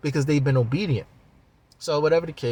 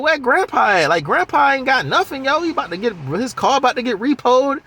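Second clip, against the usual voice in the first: high and louder, teasing grown-man slang; streetwise grown man; 11 semitones higher, 4 dB louder than usual. Retold or imitated. Ray asking where Grandpa is, loud and teasing. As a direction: brag like a grown man from a kid's mouth. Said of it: "He was like".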